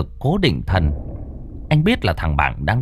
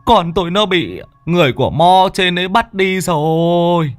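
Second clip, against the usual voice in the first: high, near-tears begging; a father close to crying at the door; choked, high desperate plea; tearful pleading; 9 semitones higher than usual. Acted. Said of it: "rưng rưng năn nỉ".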